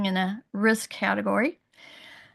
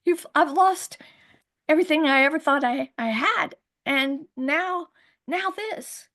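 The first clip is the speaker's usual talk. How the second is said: higher, rising, distraught lament; anguished prayer; 5 semitones higher than usual. Retold or imitated. Mother praying after the birth, anguished and higher. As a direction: cry out everything you've lost. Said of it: "on my knees going".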